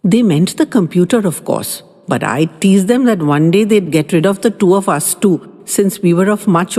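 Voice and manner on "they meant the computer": ordinary delivery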